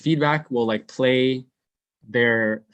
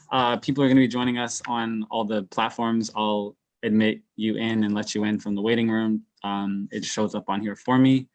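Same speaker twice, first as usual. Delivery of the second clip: usual speaking voice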